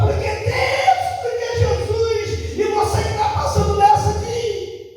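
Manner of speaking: fiery preaching. Retold or imitated. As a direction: preach it high and fervent. Retells his high-pitched preaching.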